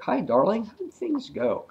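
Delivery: high sing-song housewife greeting; high mock wife voice; sweet wife voice. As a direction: coo it like a cheerful sixties wife. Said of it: "she's like".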